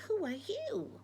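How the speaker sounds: Betty asks back, voiced not whispered. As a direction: toss the question back lightly.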